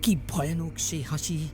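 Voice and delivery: plain reading voice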